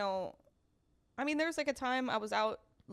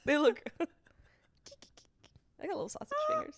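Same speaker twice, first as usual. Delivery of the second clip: whiny; 11 semitones higher, 3 dB louder, faster than usual. Real, not acted. Whining about own small hands.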